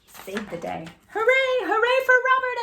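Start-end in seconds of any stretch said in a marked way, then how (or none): 1.1-2.6 high, loud hooray; cheering. Acted. Crowd cheering the horse hero, loud and high. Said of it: "everyone yelled".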